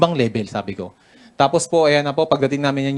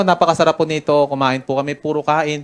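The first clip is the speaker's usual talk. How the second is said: ordinary delivery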